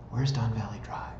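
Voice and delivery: suspenseful whisper; hushed. Real, not acted. Host building suspense before revealing the house's location, hushed. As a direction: whisper it like a secret question.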